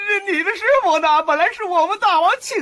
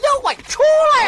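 high, loud yell to call out the monster; high shout; 9 semitones higher, 3 dB louder than usual. Acted. Pilgrim yelling for the demon to come out, a high shout. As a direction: shout up high at the cave door.

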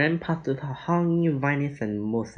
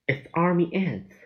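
sudden alarmed shout, higher; alarmed cry; 7 semitones higher than usual. Acted. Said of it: "cried Jack".

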